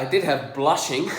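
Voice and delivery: ordinary talk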